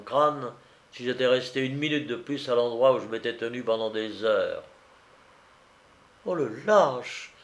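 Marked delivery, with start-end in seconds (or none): 6.3-7.3 drawn-out, slow exclamation of outrage; indignant cry. A narrator's reading. Young woman after being shot at, indignant cry. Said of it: "s'écria la jeune fille".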